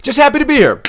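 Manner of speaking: very high, put-on happy voice; squeaky-high pitch, playfully imitating; mock happy fighter. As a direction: imitate a gleefully clueless fighter. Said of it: "not make this fucking face".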